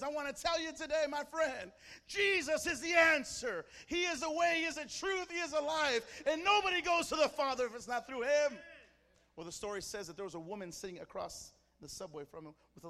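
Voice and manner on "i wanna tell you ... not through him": high, loud street-preacher proclamation; preaching out loud. Acted. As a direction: proclaim it to the whole subway carriage. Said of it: "he starts preaching out loud the gospel".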